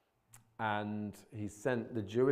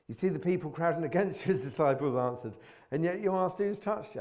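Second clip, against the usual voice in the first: incredulous disciples; 6 semitones higher, 7 dB louder than usual. Retold or imitated. Disciples answering Jesus incredulously.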